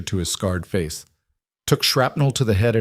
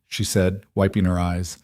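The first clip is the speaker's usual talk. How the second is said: plain reading voice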